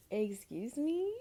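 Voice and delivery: giggling mock offense; laughing excuse me. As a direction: laugh out the indignant question. Laughing repeat of her retort.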